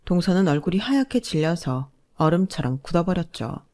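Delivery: ordinary delivery, nothing marked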